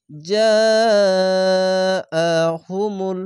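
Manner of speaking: slow recitation, first vowel of "জা" swelling and stretched; four-alif madd. Shown as a demonstration. Teacher joining the spelled word, "জা" stretched long. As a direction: hold "জা" very long, then finish "আ হুমুল". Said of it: "চার আলিফ টেনে পড়বেন".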